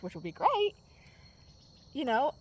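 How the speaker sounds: ordinary talk, nothing marked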